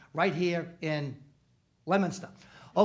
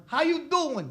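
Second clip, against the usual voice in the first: high, loud "How you doing?" in Italian-American style; Italian-American greeting; 11 semitones higher, 6 dB louder, slower than usual. Acted. Italian guy greets his old friend, loud. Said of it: "That's how we greet each other".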